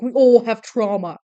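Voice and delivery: exasperated, forceful shout; loud scolding. Acted. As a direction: blurt it loudly to knock sense into someone. Voicing character's blunt scolding.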